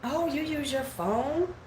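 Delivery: slow, snooty mock question; lightly mimicked snooty girl; condescending. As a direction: look down your nose as you ask. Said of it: "so, like, condescending".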